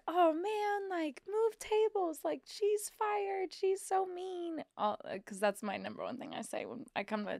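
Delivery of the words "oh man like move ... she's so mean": high, slow, drawn-out fake sympathy; put-on sympathy, pitched way up; mock commiseration. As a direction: draw it out high, playing at outrage for the player. Her stock sympathy to losing players.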